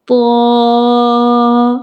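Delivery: "طا" with a thick, heavy consonant, held long; thick sound. Shown as a demonstration. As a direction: draw out a thick "طا" on a level note. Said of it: "with thick sound".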